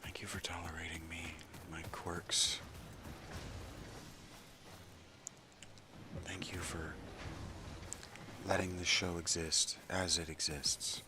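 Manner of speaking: breathy hushed thanks; whispering. Real, not acted. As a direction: whisper it close to the mic. Soft whispered thanks to viewers.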